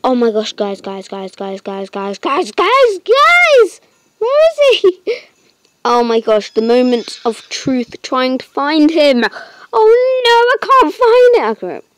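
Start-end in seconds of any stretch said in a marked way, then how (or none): 2.2-5.2 high, loud calling out; frantic excited shout. Real, not acted. Hunting for the new pet, shouting to viewers. 9.7-11.6 high, loud distressed cry; panicked wail. Real, not acted. Can't find the pet, crying out to viewers.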